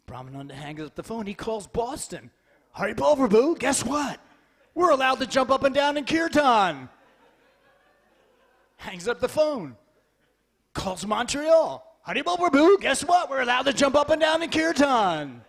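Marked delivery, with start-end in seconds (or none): 2.7-6.9 loud, thrilled phone announcement; acted excitement, calling out; excited news-breaking. Acts excited phone call spreading news. 12.0-15.4 acted thrill, full voice; excited news-breaking. Repeats excited call to next city.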